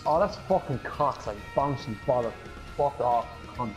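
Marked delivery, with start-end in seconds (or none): none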